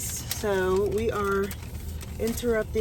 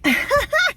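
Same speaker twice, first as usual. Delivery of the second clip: high giggle; 5 dB louder than usual. Real, not acted. Laughing at own joke.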